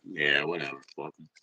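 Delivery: mock dismissive mumble. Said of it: "be like".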